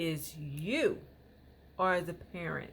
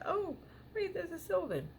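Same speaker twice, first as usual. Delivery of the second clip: much higher, a sudden pleasant discovery; retold surprise; 13 semitones higher than usual. Mom retells spotting a Sylvan nearby, surprised. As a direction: squeak it out like you just spotted it. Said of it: "I'm like".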